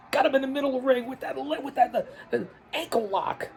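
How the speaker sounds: excited ringside play-by-play; wrestling commentator. Acted. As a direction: call the match live.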